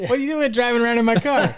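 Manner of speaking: very high, fast hammed-up indignation; mock outrage. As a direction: rattle it off like a shrill fake owner. Mock owner confronting driver.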